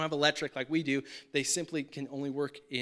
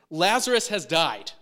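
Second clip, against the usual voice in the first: blunt and louder: "Lazarus has died." stated flat; super plainly; 7 dB louder than usual. Retold or imitated. Jesus telling disciples straight out, blunt and plain. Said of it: "like, super plainly".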